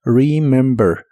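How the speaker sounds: "Remember" slowly with a soft English r and short i; "Remember" soft r, first e like i. Shown as a demonstration. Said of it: "mucho más suave".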